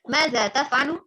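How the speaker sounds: "Mada taf'alu" lifted into a rising question; questioning intonation. Shown as a demonstration. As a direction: ask "Mada taf'alu" with the end going up. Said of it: "intonasi atau nada bertanya". ("Mada taf'alu" dengan nada bertanya yang naik)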